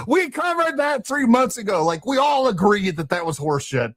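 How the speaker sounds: high, strained voice, fed up; exasperated. Retold or imitated. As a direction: pitch it up, fed up and incredulous.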